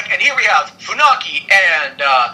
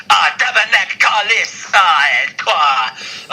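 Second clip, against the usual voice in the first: Quebecois swearing; 3 semitones higher, slower than usual. Retold or imitated. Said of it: "be like".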